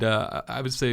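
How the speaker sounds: ordinary talk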